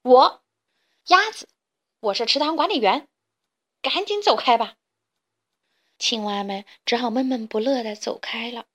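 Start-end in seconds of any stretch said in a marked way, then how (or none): 0.0-4.8 loud, high start, easing slightly; bossy proclamation and dismissal; loud declaration. A narrator's reading. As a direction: announce yourself forcefully, word by word. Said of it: "大声说".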